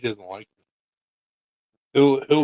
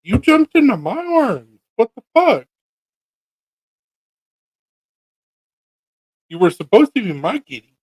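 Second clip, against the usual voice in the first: high, louder mock-hurt whine; hammed-up heartbroken whine; mock betrayed whine; 10 semitones higher, 5 dB louder than usual. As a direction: act hurt that the kitty chose someone else. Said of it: "And I'm like".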